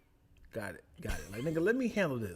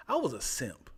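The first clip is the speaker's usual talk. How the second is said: usual speaking voice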